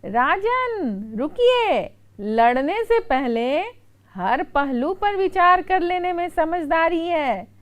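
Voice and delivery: very slow drawn-out name, then high stop; urgent plea. Retold or imitated. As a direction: halt the kings before they strike. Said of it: "वे बोले". (धीमे, खींचकर पुकारते हुए राजा को रोकना)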